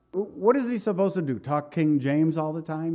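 ordinary talk, nothing marked

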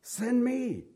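high, keen send me; a kid bidding for the teacher; eager schoolkid. Retold or imitated. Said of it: "trying to get the teacher's attention".

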